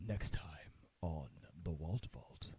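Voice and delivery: low, soft creepy trailer voice; deep end-of-episode announcer. Pretend. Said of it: "Why do we all sound creepy?".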